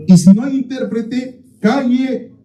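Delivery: high, commanding voice on calle; forceful scripture quote. Acted. Preacher quoting Paul's order to the congregation, commanding. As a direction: bark out the command like Paul.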